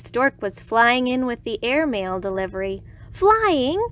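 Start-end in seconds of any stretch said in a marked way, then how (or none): 3.1-3.8 high, loud delighted exclamation; excited cry. A narrator's reading. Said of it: "cried Iguana".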